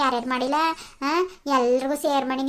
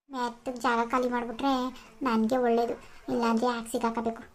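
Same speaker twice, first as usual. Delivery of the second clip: muttered under her breath, much softer; quiet aside; 3 semitones lower, 8 dB quieter than usual. Acted. Daughter-in-law to herself, softly muttering a quiet aside. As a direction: slip it in quietly so no one hears.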